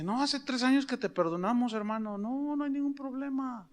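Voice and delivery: high, soft, kind reassurance; tender forgiving reply; gentle forgiveness. Retold or imitated. Forgiving the pastor retold.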